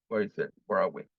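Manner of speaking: ordinary delivery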